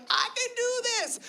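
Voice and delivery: high, slow, dreamy resolve; mock-inspired vow in a high voice; high dreamy viewer. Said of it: "you're like".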